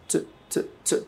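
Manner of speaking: ট as short t bursts with no vowel; bare consonant. Shown as a demonstration. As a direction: tap out ট alone, very short. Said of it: "শুধু ঠ sound আছে".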